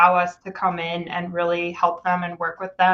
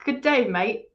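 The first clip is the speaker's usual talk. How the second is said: Australian accent; 4 semitones higher than usual. Shown as a demonstration. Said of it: "say "Good day, mate" in an Australian accent".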